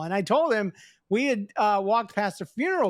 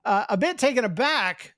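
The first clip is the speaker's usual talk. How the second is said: normal talking voice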